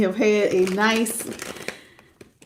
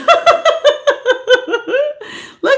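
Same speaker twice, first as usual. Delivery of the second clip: high delighted laugh; gleeful cackle at the crowd; 14 semitones higher than usual. Real, not acted. Delighted at the many viewers.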